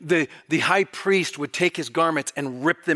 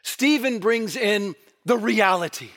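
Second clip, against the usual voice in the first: ordinary talk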